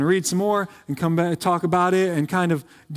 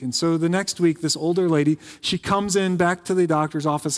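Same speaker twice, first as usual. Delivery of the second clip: usual speaking voice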